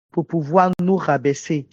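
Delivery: ordinary talk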